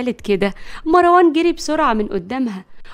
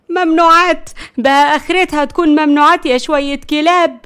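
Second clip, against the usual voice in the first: high, loud outraged rebuke; angry scolding; 4 semitones higher, 7 dB louder than usual. A narrator's reading. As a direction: tear into the boys in outrage. Grandmother scolding boys over drugs. (توبيخ بغضب وصوت عالي)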